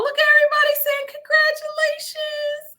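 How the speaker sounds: very high-pitched, touched squeal; overwhelmed delight. Real, not acted. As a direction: squeal it, overcome by the congratulations. Overwhelmed by viewers' congratulations.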